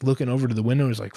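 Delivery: normal talking voice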